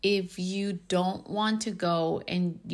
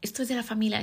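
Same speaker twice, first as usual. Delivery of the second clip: strict mom voice; 4 semitones higher, much faster than usual. Retold or imitated. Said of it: "my mom was the type que".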